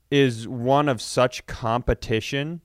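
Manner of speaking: ordinary talk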